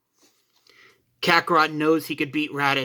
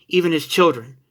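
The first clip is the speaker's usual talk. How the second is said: ordinary delivery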